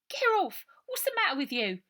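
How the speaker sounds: put-on high, loud boy yelling get off; an irritated kid shoving someone away; cross young boy. A narrator's reading. Robert snapping at Brian.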